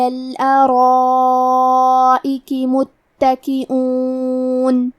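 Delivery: very slow, drawn-out Quranic chant; Quran recitation. Acted. Teacher recites the verse for students, very slow chant. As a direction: recite slowly, stretching every vowel.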